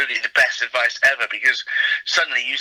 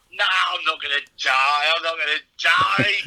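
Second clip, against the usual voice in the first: slow, self-assured boasting; brushing off the warning, slow cocky boast; cocky bravado; 4 semitones higher, slower than usual. Retold or imitated. Comedian to colleagues, slow cocky bravado. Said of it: "I'm giving it all this".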